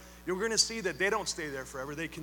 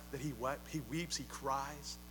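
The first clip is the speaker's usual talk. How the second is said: hushed tenderness; 5 semitones lower, 10 dB quieter than usual. Acted. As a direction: draw the listener close with a tender hush. Dwelling on Jesus weeping.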